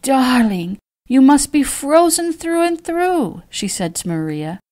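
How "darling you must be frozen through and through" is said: high, drawn-out motherly fussing; high, slow sympathetic gush; doting concern. A narrator's reading. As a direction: fuss over the guest in a high coo. Mother fusses over chilled guest.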